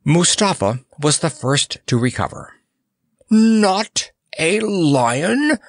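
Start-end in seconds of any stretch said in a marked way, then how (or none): 3.3-5.6 high, drawn-out outraged cry; hoarse cry. A narrator's reading. Monarch learns the clown is no lion, crying out in outrage. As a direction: cry it out slowly in high disbelief. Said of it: "cried the monarch of Mudge hoarsely".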